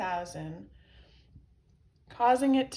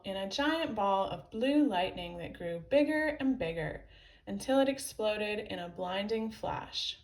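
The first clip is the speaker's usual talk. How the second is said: plain reading voice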